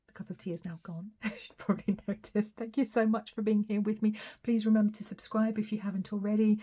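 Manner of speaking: usual speaking voice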